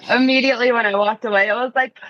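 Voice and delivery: ordinary delivery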